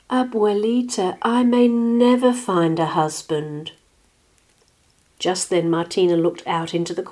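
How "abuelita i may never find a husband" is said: high, plaintive complaint; sighing lament. A narrator's reading. Martina despairs to her grandmother, sighing. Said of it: "Martina sighed".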